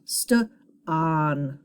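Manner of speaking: "St-on" in two parts, st then short-O on; "St-on" split after the st; sounded-out blend. Shown as a demonstration. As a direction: sound out "St-on" slowly, vowel as in octopus. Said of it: "Closed syllable".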